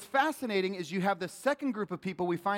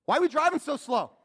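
mock shout; 10 semitones higher, 8 dB louder than usual. Mocking impatient driver.

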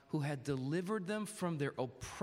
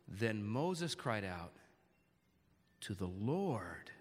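slow, low, with "to the Lord" set apart; pause, then give "Lord" long, deliberate weight; low, measured reading stressing "cried out" and "Lord"; emphasized words; 9 semitones lower, slower than usual. Shown as a demonstration. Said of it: "I'm gonna emphasize certain words".

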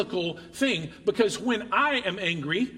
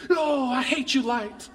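pitched up, half-hammed scolding of a warning light; mock scolding; 5 semitones higher, slower than usual. Driver mock-scolding the check engine light, pitched up and hammed. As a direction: snap at the little light in fun.

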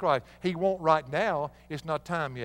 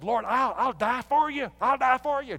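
a lightly voiced eager Peter, pitched up; 8 semitones higher, 4 dB louder than usual. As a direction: blurt it out as the over-eager disciple.

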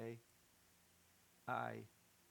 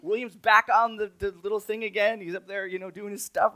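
louder, higher, panicked rush; freaking out; 10 semitones higher, 21 dB louder than usual. Real, not acted. Father panicking as dark falls and phone dies, loud and strained. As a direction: voice cracking with stress. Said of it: "I'm just freaking out".

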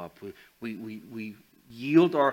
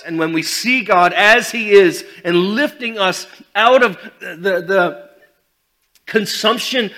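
fiery preaching; 8 semitones higher, 16 dB louder than usual. Acted. Preaching at full force.

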